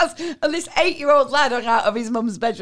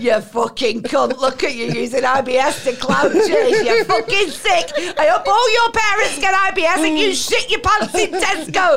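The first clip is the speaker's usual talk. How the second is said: building to a higher, louder tirade; mock troll rant; 3 semitones higher, 3 dB louder than usual. Troll attacks charity fundraiser, hammed-up furious rant.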